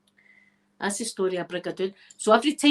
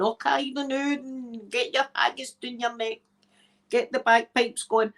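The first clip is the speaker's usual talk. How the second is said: lightly voiced merry villagers, higher pitched; higher, teasing singsong caricature; mock villagers; 4 semitones higher than usual. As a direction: playfully put on a bustling Highland townsfolk voice. Acting out the happy villagers.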